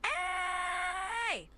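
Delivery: high, sustained shout; long high cry; emphatic held call. Shown as a demonstration. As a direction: stretch the vowel on a high steady note. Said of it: "here we go again".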